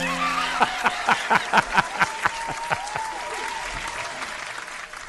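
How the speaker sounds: high wheezing laugh. Real, not acted. Laughing at his own mob joke.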